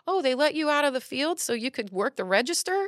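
high-pitched imitation of a rude customer; lightly acted mocking question; mock customer jibe. Imitates racist customer's remark to daughter.

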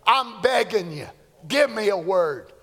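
a lightly voiced desperate plea; retold pleading prayer. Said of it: "I said".

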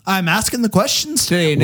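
high, lilting leprechaun voice; a poor, hammy Irish accent. Acted. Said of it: "Why are you talking like a leprechaun?".

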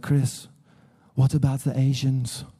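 lightly imitated German-accented question. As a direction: imitate a German wife asking earnestly. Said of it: "she's, she's German, you know".

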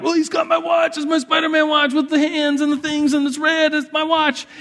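put-on high, shouted child complaint; upset kid protesting. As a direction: blurt it out like an upset first grader pleading his case.

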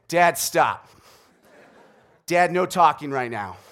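loud, firm imitation of a daughter ordering dad; mock child's scolding. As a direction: cut dad off like a bossy little daughter. Retold daughter scolding her arguing father.

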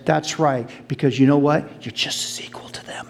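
whisper. Acted. Jesus whispering reassurance to Mary. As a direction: lean in and whisper it.

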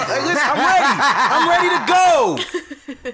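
high, excited cry of readiness; hyped outburst. Real, not acted. Host insisting to laughing friends he's ready, hyped up. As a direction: burst out high, stretching the last words.

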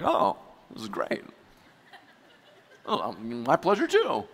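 low, hesitant start, lifting at the end; pleased customer's reply. Acted. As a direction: hesitate softly, then return the courtesy. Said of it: "You're like".

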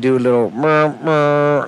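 imitating a truck's air horn; truck horn imitation. Shown as a demonstration. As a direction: mouth a horn blast, murr murr. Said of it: "like you're pulling something".

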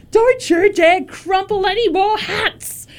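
high, loud captain's yell over the shoulder; grumpy yell. Acted. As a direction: yell it out, annoyed. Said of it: "he yells over his shoulder".

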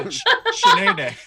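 high, laughing Shanae impression. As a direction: squeal it out like Shanae. Martin Lawrence character voice.

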